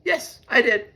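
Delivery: high squealed yes; a frightened little squeak. A narrator's reading. Mole squeals at angry Bear, high. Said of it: "squealed Mole".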